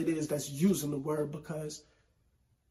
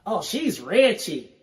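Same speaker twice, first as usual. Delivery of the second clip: put-on slow, drawn-out Southern accent; mock Southern accent saying "wretched" as "ratchet"; 3 semitones higher, 6 dB louder, much slower than usual. Host imitates how people down south say "wretched" in a slow Southern accent. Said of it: "when it comes out in that accent".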